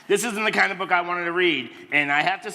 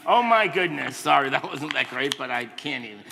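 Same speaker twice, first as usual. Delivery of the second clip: ordinary delivery, nothing marked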